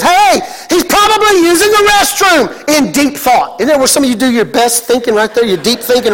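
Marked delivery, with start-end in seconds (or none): 0.0-3.6 high, hollered taunt; mocking call. Elijah mocking the silent god of Baal's prophets, high jeering call. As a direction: call it out as a jeer. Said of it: "but the Hebrew says".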